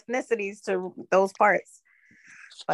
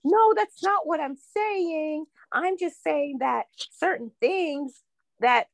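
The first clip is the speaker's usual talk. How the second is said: mocking woman's voice; 7 semitones higher, slower than usual. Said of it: "the other woman was like".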